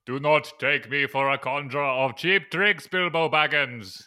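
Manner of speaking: loud, booming mock wizard; Gandalf. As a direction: thunder it out grandly, slowing on the name. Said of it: "says Gandalf".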